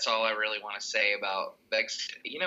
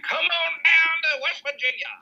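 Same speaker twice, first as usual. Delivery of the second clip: much higher, louder hammed-up invitation; cowboy governor; 6 dB louder than usual. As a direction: holler the welcome like a carnival barker. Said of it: "Governor Justice and Jerry Falwell just up there going".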